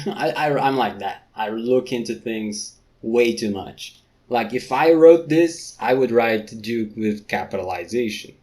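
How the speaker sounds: normal talking voice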